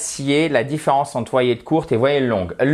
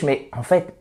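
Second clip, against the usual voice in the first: ordinary talk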